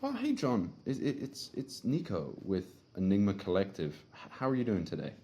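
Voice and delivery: high, stumbling, flustered greeting; confused. Shown as a demonstration. Setter greeting the lead, playing flustered and confused. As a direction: fumble the intro as if confused. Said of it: "use your tone to sound confused".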